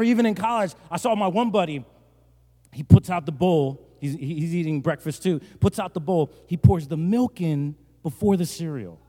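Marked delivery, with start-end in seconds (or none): none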